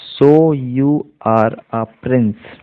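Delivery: very slow, with gaps between words. Shown as a demonstration. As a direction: model each word separately and slowly. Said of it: "word by word".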